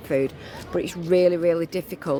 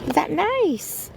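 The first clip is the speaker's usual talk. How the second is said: high, cooing pet voice; 10 semitones higher, slower than usual. Real, not acted. Feeding a hungry stray puppy, cooing baby talk to him.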